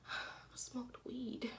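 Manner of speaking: slow, breathy whispered admission; sheepish whispered confession to the teacher. Retold or imitated. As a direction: whisper it like a guilty secret.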